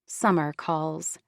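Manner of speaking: usual speaking voice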